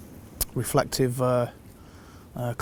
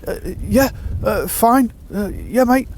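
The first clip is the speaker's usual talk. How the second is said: higher, dazed halting reply; dazed cyclist; 8 semitones higher, 5 dB louder, slower than usual. Retold or imitated. Hooked cyclist answering the angler, dazed and halting. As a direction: stammer it out like someone still in shock. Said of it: "he was just like".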